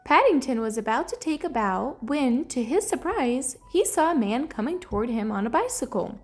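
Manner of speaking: ordinary delivery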